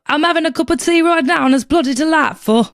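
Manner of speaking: a hammed-up English accent, sipping tea; put-on English accent. Host slipping into a mock English accent for the listener. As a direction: play the cheeky Londoner with tea in hand. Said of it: "what is this accent?".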